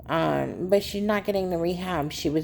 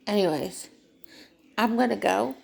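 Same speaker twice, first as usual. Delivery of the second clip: ordinary delivery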